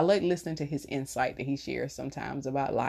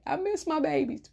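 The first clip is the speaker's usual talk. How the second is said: mock wail; 12 semitones higher than usual. Kids return home, big hug.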